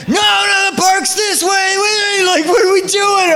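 put-on high-pitched little girl shouting no; whiny child protest. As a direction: shout it like a stubborn small daughter. Mother voices her daughter's protest.

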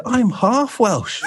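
high, drawn-out exclamation; retold excited claim. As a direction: proudly announce it, pitched right up and stretched slowly. Retold triumphant reveal to photographer.

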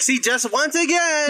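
lightly put-on stump-speech voice; mock campaign politician. Campaigner pouncing on a rival's slip, in mock stump-speech style. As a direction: play the aggrieved politician.

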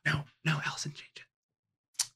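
whispered secret prompt; urgent whisper. Real, not acted. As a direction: whisper it to a fellow player. Secretly prompting another player's answer.

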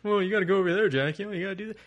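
high-pitched mock bossing around; hammed-up nagging underling; bossy henchmen. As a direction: talk down to the boss like you're in charge. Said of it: "all your guys talk down to you like they're in charge".

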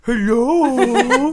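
put-on high, loud baby-talk hello. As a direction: coo it like talking to a baby. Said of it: "Maybe that's what you should change your hello".

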